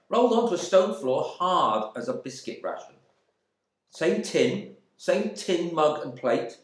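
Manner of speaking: a wronged Englishman fuming at his cellmates; indignant Bernard. Acted.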